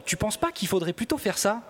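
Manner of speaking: high, leading question; imitated doubting suggestion. Colleague imitates host suggesting another way, doubtful questioning. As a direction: mimic someone gently second-guessing. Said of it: "tu fais des trucs du genre".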